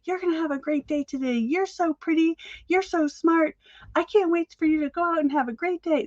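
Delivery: high-pitched imitation of a little girl; little girl pep talk. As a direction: cheer on the mirror like a small child. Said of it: "that little girl in the mirror who's like".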